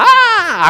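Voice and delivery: high-pitched acted shriek; hammed-up frightened scream; mock scream of fear. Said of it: "se pusieron a gritar".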